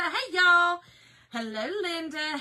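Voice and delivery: ordinary delivery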